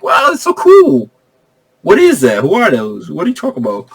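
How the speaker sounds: clueless wide-eyed awe. Retold or imitated. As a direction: act totally lost and amazed. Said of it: "He was like".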